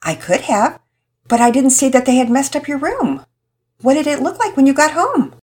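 calmly ask, not taking the bait; lightly voiced patient mom. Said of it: "was patient in her tone".

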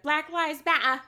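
put-on a jokey English accent on matter; British accent. Quoting a tweet mocking Brits, light British accent. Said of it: "British people be like".

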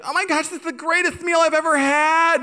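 very high imitated gushing; gushing delight. Husband imitating wife at dinner, high gushing delight. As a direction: squeal it as a hammed-up impression of delight. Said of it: "she has such a great attitude towards things".

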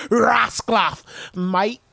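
imitating Australian. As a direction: mimic the Australian "mate".

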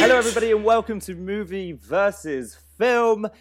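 booming podcast welcome; big show intro. Real, not acted. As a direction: belt out the welcome like a game show host. Proper show intro, loud.

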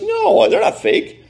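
very high, drawn-out mock protest; protesting denial. Kid denies the cards are fake, mock-indignant protest. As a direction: imitate a kid whining a slow denial.

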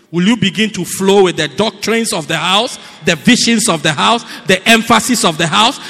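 booming preacher crescendo; preaching at full force. Acted. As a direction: build the altar call to a shout. Preaching climax with rising questions.

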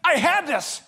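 acting out an upset son, high and loud; so mad. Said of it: "He's just so mad".

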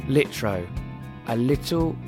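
ordinary delivery, nothing marked

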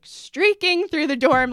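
mock horror at what she might have done; panicked disbelief. Retelling her panic to her roommate, mock horrified. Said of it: "my heart stopped".

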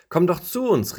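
high excited call of fallen leaves. Acted. Fallen leaves calling the lone leaf down, high and excited. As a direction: call up gleefully to the last leaf. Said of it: "riefen die".

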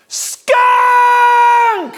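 very high, loud drawn-out shriek; imitating a panicked mother's scream; mom's scream. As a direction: scream the alarm long and high. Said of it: "my mom screams".

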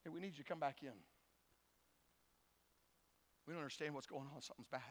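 very soft, low caller; hushed, ominous low voice; grave phone call. Acted. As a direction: break bad news quietly. Dreaded call from the doctor.

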